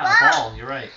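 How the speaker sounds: ordinary delivery, nothing marked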